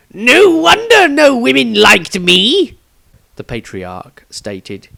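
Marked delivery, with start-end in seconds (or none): 0.1-2.8 put-on high, slow old man musing; old wizard voice. Old wizard eyeing his younger self, high and slow. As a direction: lift the voice up high like an ancient wizard looking back. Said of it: "The newcomer added".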